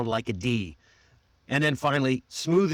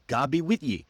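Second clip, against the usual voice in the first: GOD BE WITH YE run together more quickly; linked phrase. Shown as a demonstration. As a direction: join the old phrase into one smooth unit.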